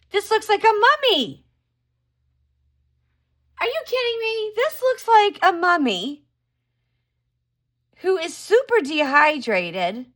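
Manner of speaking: can't believe what the card shows; surprised. Real, not acted. Shocked at the tarot card image.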